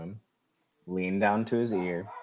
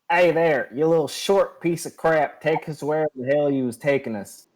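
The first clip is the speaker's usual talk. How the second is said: higher, louder bullying voice; gruff taunt; 7 semitones higher, 5 dB louder than usual. Acted. Asger threatening the small gnome guide, loud and sneering. Player's character menacing the gnome.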